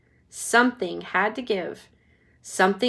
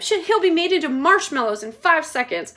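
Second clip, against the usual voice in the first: high, loud angry retort; mother snapping high and loud; 7 semitones higher, 7 dB louder than usual. Acted. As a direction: fire back angrily. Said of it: "snapped Missus Gloop".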